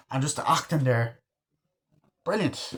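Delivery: ordinary talk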